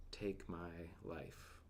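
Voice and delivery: very slow, soft, drained request; a whispered surrender to God; hushed plea. Retold or imitated. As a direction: let the words barely come out. Elijah begs God to end it.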